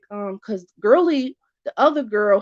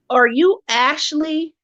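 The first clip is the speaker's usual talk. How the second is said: mocking; 5 semitones higher, 3 dB louder, slower than usual. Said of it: "he was already being funny".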